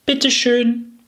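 put-on high, polite little girl voice; sweet little girl. A narrator's reading. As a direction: say it with a curtsy. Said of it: "machte einen Knicks und sagte".

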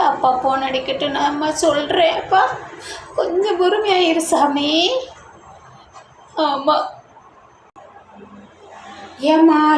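normal talking voice

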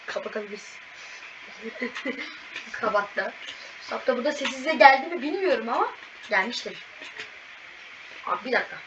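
ordinary delivery

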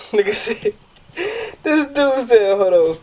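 words through helpless giggles; laughing too hard to read it. Real, not acted. Reading a funny text to viewers, laughing.

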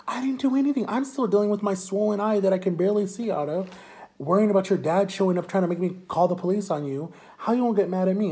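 high-pitched, defensive retort; indignant protest. Retold or imitated. Speaker arguing back at best friend in intervention, indignant. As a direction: argue back in wronged disbelief, pitch up.

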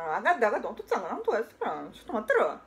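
ordinary delivery

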